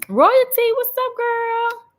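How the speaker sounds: very high, loud sing-out hello; excited greeting call. Real, not acted. Streamer hailing a viewer who just joined, excited call. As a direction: stretch out a delighted high-pitched shout-out.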